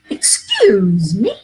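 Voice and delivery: drawn-out, emphatic Excuse me; demo of the phrase. Shown as a demonstration. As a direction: announce it like an interruption. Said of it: "The phrase we're gonna use tonight".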